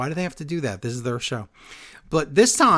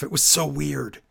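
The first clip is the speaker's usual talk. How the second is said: ordinary talk, nothing marked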